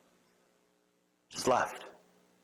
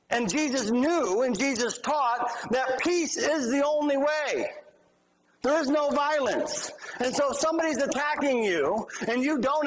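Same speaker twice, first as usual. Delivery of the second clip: raised preacher voice, higher and driving; preaching; 9 semitones higher than usual. Acted. Pastor preaching to congregation about nonviolence, raised fervent voice. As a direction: preach it from the pulpit with fervor.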